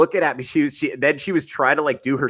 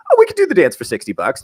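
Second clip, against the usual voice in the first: higher, louder, rushed quote of an eager stripper; quick, high mock stripper voice; imitating the stripper; 6 semitones higher, 9 dB louder, faster than usual. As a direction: blurt it as the dancer, pitched up and loud. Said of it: "And she's like".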